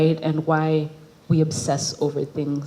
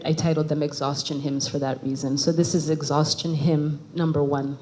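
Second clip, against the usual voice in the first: normal talking voice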